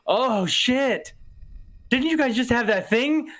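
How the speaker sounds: high, tipsy sudden realization; drunk blurting. Retold or imitated. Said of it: "and I go".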